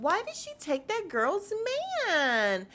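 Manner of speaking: high, lilting, played-down question; mock innocent retelling. [name] retelling her remark to Portia, mock innocent. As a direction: imitate her softened version, pitched well up. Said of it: "that's how she said it".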